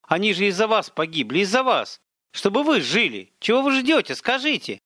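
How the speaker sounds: a distraught woman accusing the man; accusing outburst. A narrator's reading. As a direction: throw the blame at him. Zina accuses Viktor.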